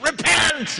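very high, loud cry; hammed-up hellfire shout; mock preaching shout. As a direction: yell it like a street preacher. Imagined street preacher's shouted call.

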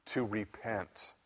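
slow, soft repeat; solemn quiet echo. Acted. Preacher repeating Paul's word to the congregation, hushed. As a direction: let it sink in softly and slowly.

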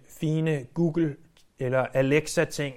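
ordinary talk

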